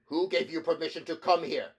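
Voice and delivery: loud, high angry challenge; furious demand. A narrator's reading. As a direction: demand it in fury. Ampliatus confronts angrily.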